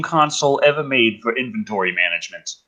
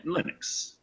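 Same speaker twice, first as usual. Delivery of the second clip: breathy whisper of a word; 2 semitones higher, 14 dB quieter than usual. Real, not acted. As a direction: whisper it to yourself. Whispered correction.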